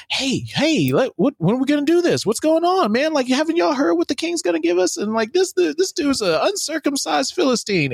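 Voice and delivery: put-on high, fast, pushy kid voice; excited teen hype. David gassing up the army, high and fast.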